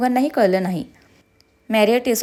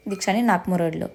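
usual speaking voice

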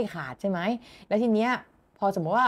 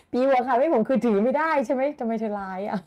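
laughing; 4 semitones higher than usual. Real, not acted. Teacher laughing at chat.

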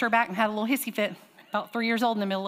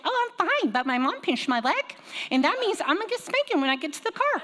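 put-on much higher childlike chirp; little girl voice; 8 semitones higher than usual. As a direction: chirp it like a cheerful three-year-old. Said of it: "She said".

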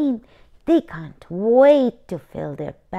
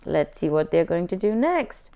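plain reading voice

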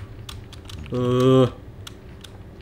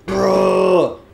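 annoyed bruh; 9 semitones higher, 3 dB louder than usual. Real, not acted. Annoyed at piglin.

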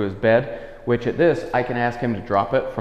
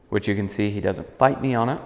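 ordinary talk, nothing marked

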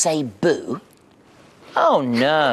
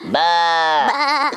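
high bleat; sheep bleating; 9 semitones higher than usual. Acted. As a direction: baa like a sheep. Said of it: "And a sheep says".

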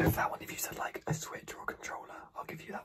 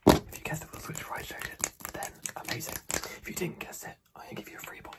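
ordinary delivery

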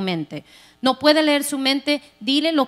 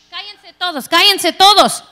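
1 loud order; 5 semitones higher, 7 dB louder than usual. Retold or imitated. Husband silencing family during call.

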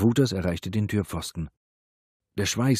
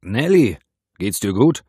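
raised, anxious check-in; worried calling; 3 semitones higher, 10 dB louder than usual. A narrator's reading. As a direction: call her name out in alarm. Calling to the captive woman.

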